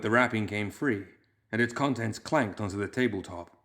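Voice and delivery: plain reading voice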